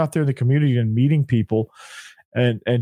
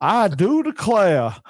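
slow, higher mock Southern accent; mock Southern belle; 5 semitones higher, much slower than usual. Co-host parodying the coach in a hammed-up Southern accent. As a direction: camp it up like a Southern gentleman. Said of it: "he's trying to be Southern".

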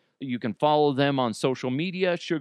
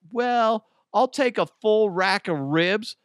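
higher, slow grand request; son ordering big; 9 semitones higher, 4 dB louder, slower than usual. Retold or imitated. Son asking at the counter, high, slow and grand. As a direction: order like a little big shot. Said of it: "my son going".

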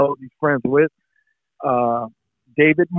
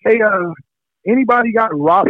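retold shout; 7 semitones higher than usual. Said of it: "screaming into a room of a bunch of guys".

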